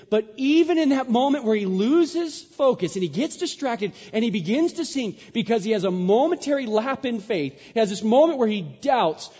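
loud, high sermon climax; preaching at full force. Acted. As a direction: drive the story home to the congregation.

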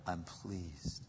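very soft, low divine reply; God's quiet reassurance. Acted. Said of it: "But he goes".

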